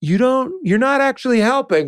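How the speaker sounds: high, mock-scolding voice; a high mock scold at the bear. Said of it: "we weren't even doing any of the".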